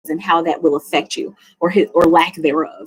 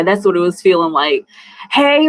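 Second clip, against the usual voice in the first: ordinary delivery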